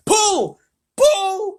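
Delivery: very high, loud drawn shout. A narrator's reading. James ordering the team to haul, urgent shout. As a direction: yell the command at full voice. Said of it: "shouted James".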